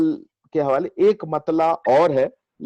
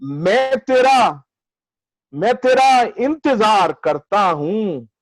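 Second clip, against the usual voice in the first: measured, higher mushaira declamation with lengthened vowels; ghazal recitation; 8 semitones higher, 5 dB louder, slower than usual. Acted. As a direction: recite the verse grandly, drawing out each phrase.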